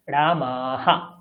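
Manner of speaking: slow "রামাহা", visarga echoed as "হা"; slow pronunciation demo. Shown as a demonstration. Teacher repeating the model for students, slow. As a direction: drawl "রামাহা" carefully for the class. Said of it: "এরকম ভাবে বিসর্গের উচ্চারণটা হবে".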